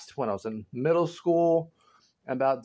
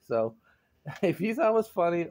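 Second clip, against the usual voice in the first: ordinary talk, nothing marked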